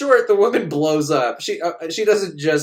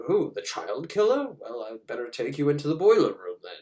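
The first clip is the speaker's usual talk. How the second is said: creepy doctor. Retold or imitated.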